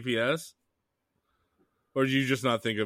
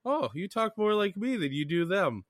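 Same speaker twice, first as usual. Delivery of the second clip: raised quote; 9 semitones higher than usual. Retold or imitated. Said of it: "I'm like".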